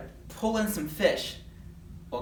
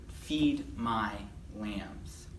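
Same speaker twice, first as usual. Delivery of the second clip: low, soft, word by word; gentle command with pauses between words; solemn memory verse; 6 semitones lower, 5 dB quieter, much slower than usual. Acted. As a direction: recite it softly, sinking at the end.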